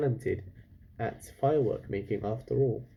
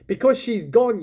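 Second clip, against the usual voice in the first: exasperated shout; 9 semitones higher, 6 dB louder than usual. A narrator's reading. Father scolding Chulak.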